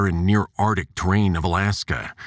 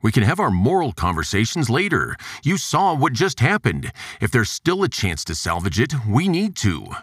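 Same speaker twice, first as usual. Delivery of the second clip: raised, urgent male voice; Juergens voice; 7 semitones higher than usual. Acted. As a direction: lift the pitch for the pushy scientist.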